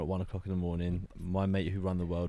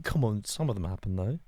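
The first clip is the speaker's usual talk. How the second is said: ordinary talk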